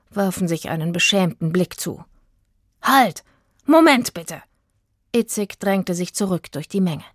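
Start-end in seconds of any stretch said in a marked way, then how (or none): 2.8-4.4 laut und hoch gerufen; Rufen. Acted. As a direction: ruf die Menge laut zurück. Itzig ruft die Menge zurück. (laut rufend)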